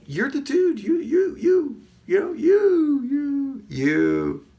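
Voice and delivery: high, loud hyped-up mirror pep talk; excited self-hype. Acted. Pumping up own reflection in the mirror, excited. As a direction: whoop it up, very high, stretching the vowels. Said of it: "exciting. I'm like".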